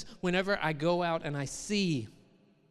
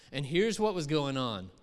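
normal talking voice

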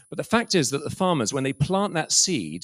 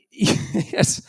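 ordinary talk, nothing marked

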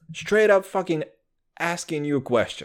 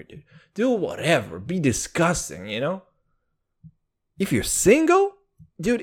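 ordinary delivery

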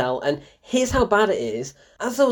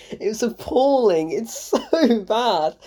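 higher pitch, breaking into laughter; laughing dismay; 4 semitones higher than usual. Real, not acted. Critic recalling an amateurish film, laughing in dismay. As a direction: laugh through it, stretching out the verdict.

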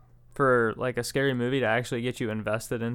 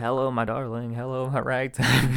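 put-on old-time twenties-to-fifties American accent; 5 dB louder than usual. Showing off the old-time talk to a friend, fifties American accent. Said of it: "it's a fire accent. I love the, like, n-, the fifties core accent".

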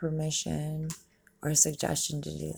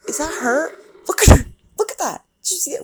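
startled high exclaim; 10 semitones higher, 11 dB louder, much faster than usual. Real, not acted. Startled by movie creature.

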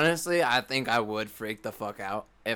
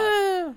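much higher mock sob; high crying whimper; 4 dB louder than usual. Retelling his mom's reaction with a mock cry. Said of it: "I was like".

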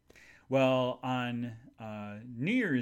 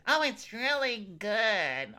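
high, drawn-out put-on praise; mock gushing stranger; 8 semitones higher, slower than usual. Stranger praising a movie to another browser, mock gushing. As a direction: imitate an over-keen recommender, slowly.

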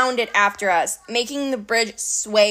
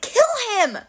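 high, fierce shout; 11 semitones higher than usual. Acted. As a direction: demand the kill. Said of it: "Clarisse shouted".

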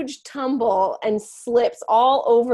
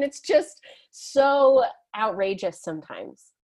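normal talking voice

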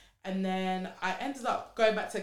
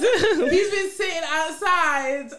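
slow, louder, laughing emphasis; drawn-out disbelief; 6 semitones higher, 9 dB louder, much slower than usual. Real, not acted. Telling friends her ex waited outside, drawn-out and incredulous. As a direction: stretch it out loudly through laughter.

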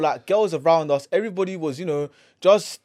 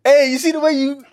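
laughing squeal; 10 semitones higher, 5 dB louder than usual. Retold or imitated. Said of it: "if I was like".

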